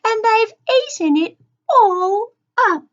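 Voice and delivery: high, loud little bear cub; Baby Bear, small crying voice. A narrator's reading. Baby Bear sees his porridge is all gone, high loud cry. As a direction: wail it high like an upset cub. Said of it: "cried Baby Bear in a small voice".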